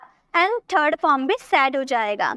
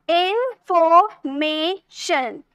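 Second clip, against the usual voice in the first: Information slowly in four beats, in, for, may, shun; syllable by syllable; much slower than usual. Shown as a demonstration. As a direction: break information into drawn-out pieces. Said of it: "चार syllable हो गए".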